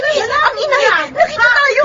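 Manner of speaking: high, panicked whisper-yell; scramble, we're caught; panicked alarm. Acted. Minet warning her lover they've been caught, panicked.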